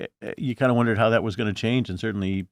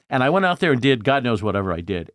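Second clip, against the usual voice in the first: ordinary talk, nothing marked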